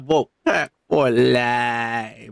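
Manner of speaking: slow deep catchphrase. Pretend. Host repeating the sign-off, slow and deep. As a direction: drag the words out low and slow.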